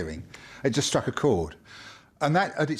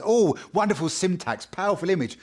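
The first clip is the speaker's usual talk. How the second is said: mocking a literary critic; 6 semitones higher than usual. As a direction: send up a fussy poetry critic. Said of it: "anally retentive prat sort of pouring over this".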